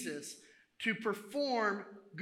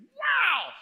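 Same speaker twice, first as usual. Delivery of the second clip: high, loud wow; amazed admiration; 13 dB louder than usual. Acted. Visitor looking through the door glass, amazed. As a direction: burst out in awed delight.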